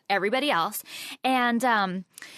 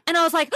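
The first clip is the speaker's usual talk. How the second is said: retold gasp; 6 semitones higher, 5 dB louder, faster than usual. Startled by a sound in the glass.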